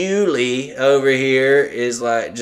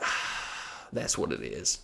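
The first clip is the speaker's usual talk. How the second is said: quiet realization; 10 semitones lower, 16 dB quieter, much slower than usual. Retold or imitated. Realizing why the dog follows.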